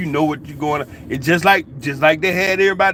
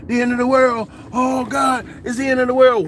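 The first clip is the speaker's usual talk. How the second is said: higher-pitched mock doom cry; lightly mocked alarmed preacher; 8 semitones higher than usual. Imitating a preacher on the Mayan calendar, mock alarm. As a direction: imitate a preacher wailing about doomsday. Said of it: "then you had the preacher".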